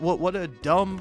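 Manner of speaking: ordinary talk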